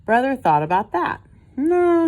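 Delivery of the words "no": high, loud refusal; a brother's loud, high no. A narrator's reading. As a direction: shut the idea down hard. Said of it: "he said".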